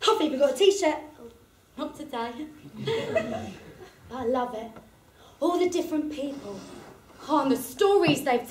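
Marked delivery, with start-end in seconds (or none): 2.5-3.5 a high, soft giggle; high little giggle. Real, not acted. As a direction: giggle at yourself, much higher. Giggling at her own joke.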